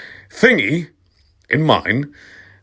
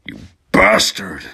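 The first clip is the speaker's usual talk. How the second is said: shouted angrily. Acted. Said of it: "I shouted angrily".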